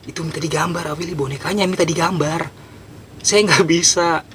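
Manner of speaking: high, imitated pleading; acted helpless protest. Telling Awili the ghost wants its doll drawn, helpless protest. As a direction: protest helplessly, drawing out the last words.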